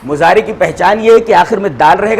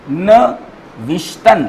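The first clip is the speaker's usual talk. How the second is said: slow teaching read; much slower than usual. Shown as a demonstration. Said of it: "अब नविश्तन".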